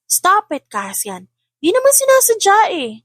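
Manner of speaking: put-on a cross older boy snapping at his siblings; annoyed child voice. A narrator's reading. Cassian scolding siblings: high, annoyed child voice.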